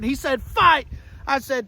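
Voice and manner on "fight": high, punchy mock battle cry; mock rallying cry. Trump urging the crowd, mock rallying cry.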